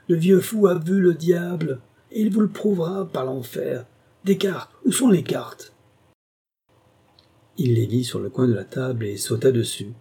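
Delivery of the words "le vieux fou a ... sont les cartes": much higher, louder wild fury; rave, demanding the cards; frenzied rage. A narrator's reading. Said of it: "sa colère se rua encore sur Alan".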